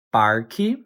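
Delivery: "parque" with a sounded R, rising at the end; regional variant. Shown as a demonstration. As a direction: say "parque" as PAR-kee with a questioning lift. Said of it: "you might also hear it pronounced as".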